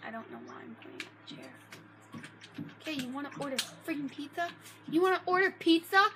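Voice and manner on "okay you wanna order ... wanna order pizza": rising, rapid outrage at the pizza order; exasperated scolding. Acted. Gamer scolding his Sim for ordering pizza, exasperated. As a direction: scold them like a fed-up parent.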